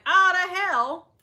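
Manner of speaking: loud high annoyed grumble; exasperated. Retold or imitated. Driver too upset to eat, exasperated. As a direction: huff it out, fed up. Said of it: "I'm like".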